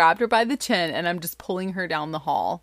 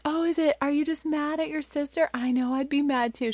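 higher-pitched, gentle mommy questioning; retold coaxing mother; 9 semitones higher than usual. Mom to crying daughter, high retold coaxing. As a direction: coax a crying child, replayed.

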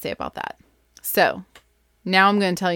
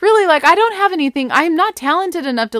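eager pleading; 10 semitones higher, 6 dB louder than usual. Retold or imitated. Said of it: "I said".

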